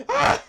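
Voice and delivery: high, loud shriek of surprise; short startled scream; sudden scream. Real, not acted. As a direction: scream as you get hit. Scream in game.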